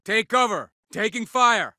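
high shout under fire; urgent warning. Acted. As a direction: warn the squad to move.

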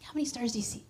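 teasing hushed question; a playful God whispering. Retold or imitated. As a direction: whisper it like baiting a child.

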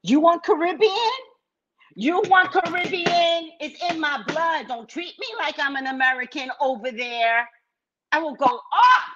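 put-on high-pitched, combative Caribbean accent; going Caribbean. Customer threatening a rude clerk, Caribbean accent. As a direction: threaten in a rising, stretched Caribbean lilt. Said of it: "I will become Caribbean on your ass".

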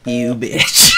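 usual speaking voice

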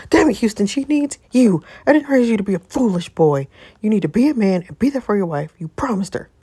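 loud, furious mother scolding her son; angry scolding. Acted. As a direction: tear into him like a disappointed mother.

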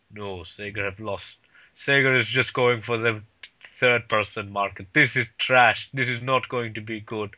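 low, dismissive imitation of a friend; friend's deep voice. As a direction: put on your friend's deep voice, pitched well down. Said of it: "He, he has a very deep voice".

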